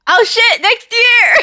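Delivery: shocked fan shout; surprised shout. Real, not acted. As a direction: blurt it out, caught off guard. Reacting to an announced date.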